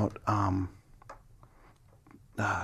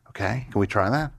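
ordinary delivery

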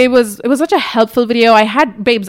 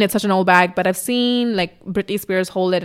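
ordinary talk

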